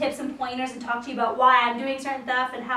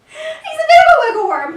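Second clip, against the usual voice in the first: laughing; 13 semitones higher, 9 dB louder than usual. Real, not acted. Laughing at wriggling puppy.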